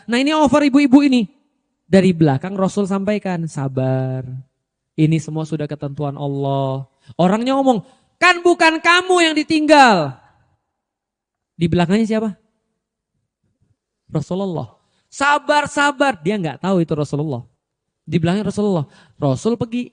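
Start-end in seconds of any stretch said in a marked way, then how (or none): 3.5-6.8 soft, low consoling words; calm her quietly; gentle comfort. Retold or imitated. Prophet gently consoling a grieving widow from behind. 8.2-10.2 high, sharp retort; bitter retort. Retold or imitated. Grieving widow snapping at the man behind her, high. 15.1-16.2 high, sing-song mockery; mocking repeat. Widow mocking the Prophet's advice, high and scornful.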